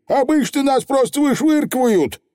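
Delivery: high, cocky little Feegle; squeaky, eager pixie chief; high cheerful Feegle boast. Acted.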